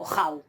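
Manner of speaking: "jau" with an aspirated h before the au glide; rough breathing. Shown as a demonstration. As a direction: start "jau" on a puff of h, one syllable. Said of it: "el suave como el áspero".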